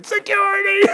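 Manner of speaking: mock panicked scream for security. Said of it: "he started screaming".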